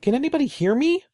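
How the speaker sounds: very high, loud and fast call for help; panicked shrill yell; shrill scream. Acted. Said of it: "His shrill voice echoed".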